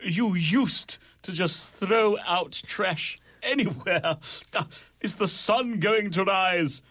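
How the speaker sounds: put-on theatrical British accent, swooping high with long pauses. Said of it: "I'm gonna do a British accent".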